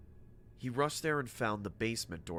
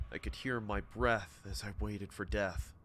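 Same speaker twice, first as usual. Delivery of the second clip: ordinary delivery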